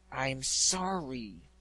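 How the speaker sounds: a slow grudging growl. Acted. Griffin forcing an apology to the pegasus, slow growl. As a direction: force the words out through pride. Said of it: "she growled".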